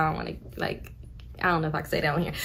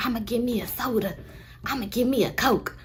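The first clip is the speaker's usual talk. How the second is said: aunt's voice; 3 semitones higher, 7 dB quieter than usual. Retold or imitated. Aunt Holiday voiced asking soda.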